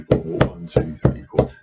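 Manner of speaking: steady counted beat, stress on one; counting the beat. Shown as a demonstration. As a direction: count it out in time with the drum. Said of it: "Just get used to doing that".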